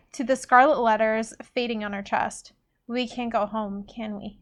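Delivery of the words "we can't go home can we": softer, subdued question; breathed line. A narrator's reading. Sophie asks Agatha about going home, breathed softly. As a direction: let it out softly, fading at the end. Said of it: "Sophie breathed".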